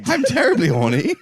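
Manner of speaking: mock British accent. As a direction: make it a put-on British confession. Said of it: "a Hugh Grant movie".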